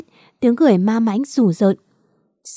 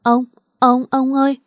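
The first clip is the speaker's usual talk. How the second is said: put-on crying child; 4 semitones higher than usual. A narrator's reading. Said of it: "cô bé khóc mếu máo".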